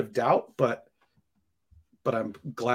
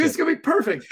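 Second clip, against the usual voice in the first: high, laughing mock glee; sarcastic giggle; laughing sarcasm; 3 dB louder, faster than usual. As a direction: say it through laughter, mocking.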